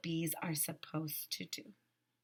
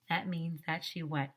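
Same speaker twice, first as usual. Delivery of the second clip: usual speaking voice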